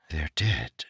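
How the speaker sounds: soft, stunned near-whisper; quiet words of sudden grief. A narrator's reading. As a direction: breathe it out in shock. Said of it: "he whispered".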